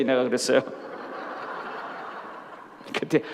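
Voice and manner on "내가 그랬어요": ordinary delivery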